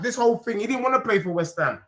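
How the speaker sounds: usual speaking voice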